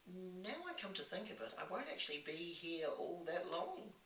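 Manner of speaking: put-on high-pitched girl, thinking aloud; little orphan girl. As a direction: reason it out as a young girl. Voices the girl Sophie.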